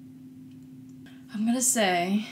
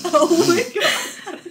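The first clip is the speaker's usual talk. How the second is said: laughing; 10 semitones higher, 8 dB louder, much slower than usual. Real, not acted. Laughing at lawnmower sound.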